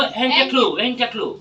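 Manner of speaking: "এন্টাক্লু" run together as one word; fast English. Shown as a demonstration. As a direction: blend the phrase into a quick "এন্টাক্লু". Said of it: "first English বলছি".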